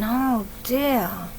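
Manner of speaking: a hint of a dismayed mother; lightly voiced mummy sighing over the mess. Sophie's mummy looking at the empty table, mildly dismayed. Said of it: "Look at Sophie's mummy going".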